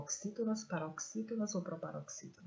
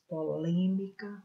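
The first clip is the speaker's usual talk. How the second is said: "polêmica" with a loud, long "lê"; stress on LÊ; 7 dB louder, slower than usual. Shown as a demonstration. Teacher showing the strong syllable, "polêmica" stressed on "lê". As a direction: punch the second syllable of "polêmica". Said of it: "dar ênfase".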